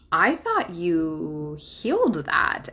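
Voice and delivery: slow, lightly voiced husband, gently pointed; a hint of the husband, slow and knowing; husband's reply. Husband reminding wife she'd healed it, slow knowing voice. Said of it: "he was like".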